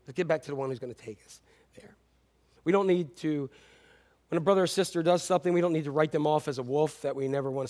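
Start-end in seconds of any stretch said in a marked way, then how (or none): 0.1-2.0 gentle coaxing. Pretend. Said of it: "We need to say".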